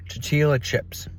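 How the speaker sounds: ordinary talk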